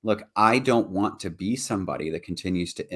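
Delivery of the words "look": ordinary delivery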